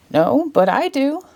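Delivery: a hint of an eager dog; dog's voice. A narrator's reading. Dog in the audience speaking up, lightly voiced. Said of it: "barked a dog in the audience".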